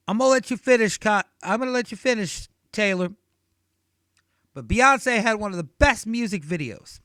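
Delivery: loud, high mock [name] cutting in; hammed-up [name] taking the stage; [name] interrupting. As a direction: barge in and grab the mic. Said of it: "He goes".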